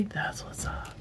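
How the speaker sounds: soft unvoiced whisper. Real, not acted. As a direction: whisper it to yourself. Aside after reading a comment.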